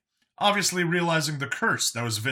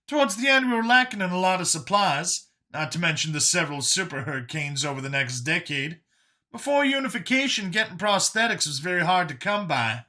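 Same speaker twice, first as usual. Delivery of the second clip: high-pitched character voice; high, emphatic storyteller in character; Brian recounting hard times; 7 semitones higher than usual. Acted. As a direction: tell the history in a pitched-up voice.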